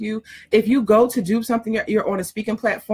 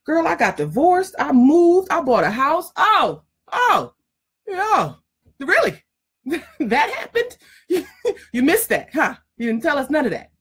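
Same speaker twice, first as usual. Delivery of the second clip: much higher, lively voice of a client suddenly remembering her life; gushing, much higher amazed recollection; excited client remembering; 6 semitones higher than usual. Retold or imitated. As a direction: play the client gasping at everything she forgot, pitched way up. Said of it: "and they be like".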